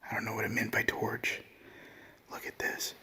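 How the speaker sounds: hushed whisper, voice barely there. Real, not acted. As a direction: whisper so nothing hears you. Ghost hunt inside the monastery.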